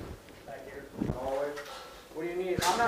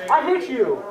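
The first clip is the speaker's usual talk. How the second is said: shouting; 6 semitones higher, 7 dB louder, slower than usual. Real, not acted. Calling a hit on enemy.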